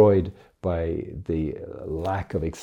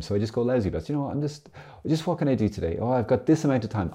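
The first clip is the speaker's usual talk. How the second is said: ordinary delivery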